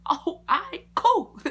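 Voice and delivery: high, loud surprised cool; surprised agreement. Retold or imitated. Answering the barber, high loud surprised okay. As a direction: squeal it out.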